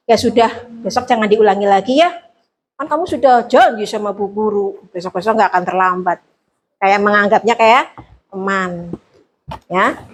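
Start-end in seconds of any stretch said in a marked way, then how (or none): 0.1-6.2 quick friendly reprieve. Shown as a demonstration. Teacher quickly letting a late pupil off like a friend, fast and easy. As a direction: brush it off lightly like a friend.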